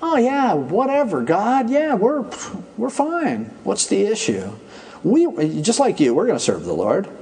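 high, airy "whatever" shrug; unbothered, breezy dismissal; careless attitude. Retold or imitated. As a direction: toss it off without a care. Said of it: "That's kind of their attitude".

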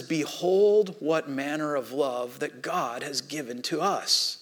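slow, soft reading of the verse; scripture reading. Acted. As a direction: read it reverently and slowly.

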